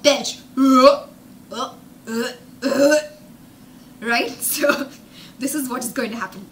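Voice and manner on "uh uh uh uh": burp sounds. Pretend. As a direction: fake some burps. Teacher imitates burping sounds.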